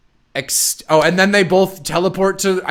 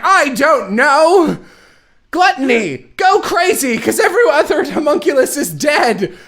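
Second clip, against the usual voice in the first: mock villain; 10 semitones higher than usual. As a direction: ham up a silly villainess. Villain Dante ordering Gluttony.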